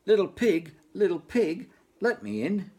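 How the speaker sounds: high, loud wolf call at the door. Acted. Wolf at the stick house door, loud high call. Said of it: "knocked loudly at the door and cried".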